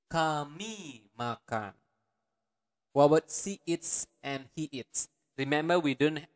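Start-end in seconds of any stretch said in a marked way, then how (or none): 0.1-1.7 slow demo. Shown as a demonstration. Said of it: "teacher models slow phrase".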